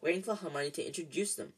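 plain reading voice

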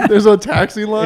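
high, loud, laughing disbelief. Real, not acted. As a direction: laugh it out in surprise. Surprised laughing question.

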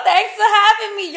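hammed-up street girl greeting, laughing; street slang voice. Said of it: "Is that, yo".

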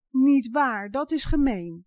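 put-on lightly sobbed child's protest; understated sobbing. A narrator's reading. Janneke protests to mother, lightly sobbing. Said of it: "snikt Janneke".

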